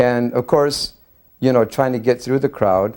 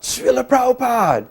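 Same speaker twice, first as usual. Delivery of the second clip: high-pitched excited yell; retold yell. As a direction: cry out the name far above your usual pitch. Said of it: "I yelled out".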